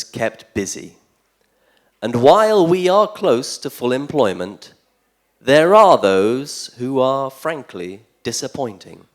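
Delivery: declaimed satirical poem, emphatic with formal pauses; mock election speech. Poet playing a candidate pitching to voters, mock-earnest speechifying. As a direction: deliver it like a candidate's pitch, pressing the key phrases.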